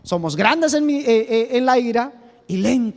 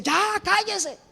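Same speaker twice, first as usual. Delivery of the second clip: put-on snapping at kids; 12 semitones higher, slower than usual. Parent imitated snapping at shouting children to hush. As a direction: bark it at noisy children. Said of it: "y usted dice".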